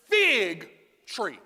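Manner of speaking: "fig" punched loud and stretched, then "tree" dropping away; stress on fig. Shown as a demonstration. Preacher demonstrating for congregation, "fig" heavily emphasized. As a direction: hit "fig" hard, hold it, then let "tree" fall. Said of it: "emphasize the word fig in fig tree".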